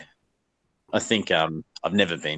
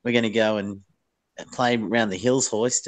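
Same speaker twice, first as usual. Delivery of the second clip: higher-pitched, hammed-up Ocker Australian accent; broad Ocker Aussie; 4 semitones higher than usual. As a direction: typical Ocker Aussie put on for laughs. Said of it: "there's definitely dudes like that".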